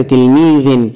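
تلميذ as tilmiidhin; kasra tanween. Shown as a demonstration. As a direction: end تلميذ in "in". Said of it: "وبالكسر".

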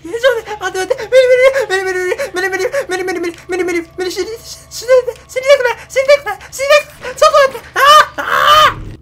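very high, loud half-crying scream; hit hard crying out. Real, not acted. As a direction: scream in terror, almost in tears. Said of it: "地味に半泣きなんだけど". (半泣きの絶叫)

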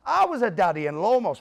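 loud, high boast. Retold or imitated. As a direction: bark it right in his face. Jock squares up to Frank.

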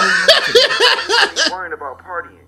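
scolding mom. Acted. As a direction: scold him like an outraged mother.

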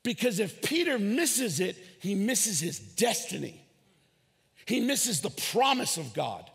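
usual speaking voice

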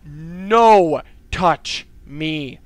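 loud, very slow, drawn-out words; alarmed warding-off. Real, not acted. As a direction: fend it off loudly, word by word. Warding off the monster.

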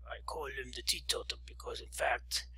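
hushed, breathless aside; whispered. Acted. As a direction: whisper it low to the table. Friend whispering.

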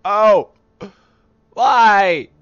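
loud rattled protest. Real, not acted. After jump scare.

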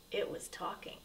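whispered with suspense; hushed reveal. A narrator's reading. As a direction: breathe it like a secret.

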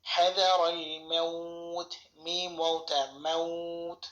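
হাদারাল মাউত with the vowel held very slowly; long madd. Shown as a demonstration. Teacher modelling the stretched vowel for students. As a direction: draw out মাউত slowly, two alif long. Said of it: "উচ্চারণ হবে".

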